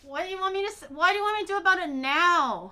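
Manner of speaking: high, rising exasperated demand. Real, not acted. Exasperated at a late notification.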